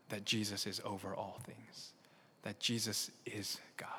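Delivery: soft whisper of Jesus is God; whispered point. Real, not acted. Pastor whispering the core claim to listeners, hushed. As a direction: lean in and whisper it.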